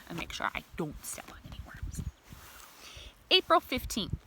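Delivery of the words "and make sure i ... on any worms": soft whispered aside. Real, not acted. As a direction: share it in a whisper. Whispered aside about worms.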